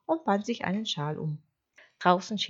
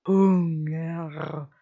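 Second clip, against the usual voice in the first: hint of a growling wolf, louder; hungry wolf; 6 dB louder than usual. Wolf answering the sheep, hungry growl. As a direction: let the word out as a hungry growl. Wolf names his hunger.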